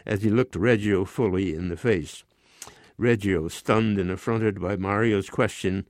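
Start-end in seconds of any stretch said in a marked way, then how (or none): none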